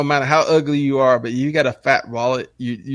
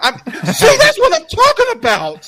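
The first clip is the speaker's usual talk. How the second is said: very high, louder falsetto with laughter; shrieking mock kid; 6 dB louder than usual. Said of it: "She's like".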